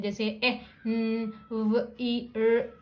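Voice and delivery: "ए, न, व, ई, र" as separate letter sounds; letter-by-letter sounding. Shown as a demonstration. Teacher showing parents letter blending, each of ए, न, व, ई, र sounded separately. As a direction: sound out ए, न, व, ई, र one by one. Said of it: "तो try blending".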